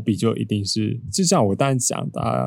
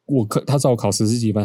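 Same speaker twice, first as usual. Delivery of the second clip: normal talking voice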